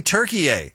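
mocking "Turkey" with a tacked-on "eh"; Turkey with added eh. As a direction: overdo "Turkey" as tur-kee-EH, falling on the end. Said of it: "nobody from Turkey is saying".

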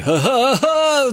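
high choppy ha ha ha; imitated funny laugh. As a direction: do his odd staccato laugh. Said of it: "does the funny laugh".

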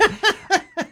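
breathless high laugh. Real, not acted. Laughing at chihuahua with axe.

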